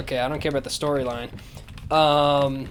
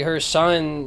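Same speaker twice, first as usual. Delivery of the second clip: ordinary delivery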